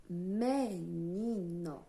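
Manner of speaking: "menino" slowly as me, ni, no; teacher sounding out "menino"; split into syllables. Shown as a demonstration. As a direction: stretch "menino" into three beats, me-ni-no. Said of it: "eu abro a boca quantas vezes".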